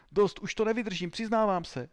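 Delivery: high-pitched cry of giving up; desperate surrender. Acted. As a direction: break down and confess under blows. Beaten suspect breaks and confesses.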